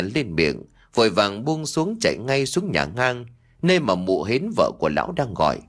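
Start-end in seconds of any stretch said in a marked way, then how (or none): none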